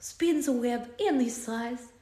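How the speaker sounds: slow, overdramatic Russian-accented recital with big pauses; dramatic reading in a Russian accent. Shown as a demonstration. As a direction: read it gravely like a Russian poet, then trail off soft. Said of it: "This is a dramatic reading of the Spider-Man theme song as done by Ritu".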